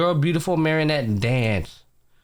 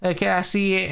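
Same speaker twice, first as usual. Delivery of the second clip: high-pitched impressed hype; gassing her up; 9 semitones higher than usual. Retold or imitated. Retelling him praising her magic, admiring hype. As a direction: imitate an admirer hyping someone up. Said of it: "he basically gasses up like".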